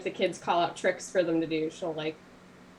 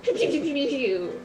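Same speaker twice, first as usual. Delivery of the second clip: high, loud pretend laser shots; pew-pew attack noises; 6 semitones higher, 5 dB louder than usual. Reenacting Sparkle attacking a little gnoll, high mock zapping. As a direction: fire off playful high-pitched battle sounds. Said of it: "goes like".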